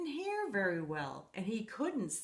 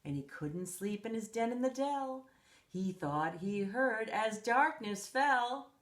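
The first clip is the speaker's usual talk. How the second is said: ordinary delivery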